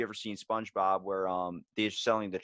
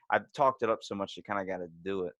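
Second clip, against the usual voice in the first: normal talking voice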